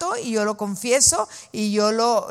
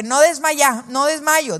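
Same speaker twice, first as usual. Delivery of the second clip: higher, louder declaration; said strongly; 4 semitones higher, 3 dB louder, slower than usual. Shown as a demonstration. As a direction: proclaim it out loud. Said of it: "dilo fuerte". (declaración fuerte)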